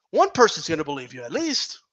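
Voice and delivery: ordinary delivery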